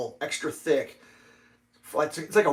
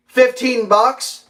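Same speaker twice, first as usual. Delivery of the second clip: mock clerk haggling; 8 semitones higher, 10 dB louder, much slower than usual. Clerk counteroffer, slow.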